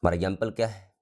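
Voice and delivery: ordinary delivery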